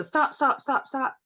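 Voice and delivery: high-pitched panicked pleading, imitated; mock screaming. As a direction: act out a squealed plea to stop. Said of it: "he's screaming and flopping around".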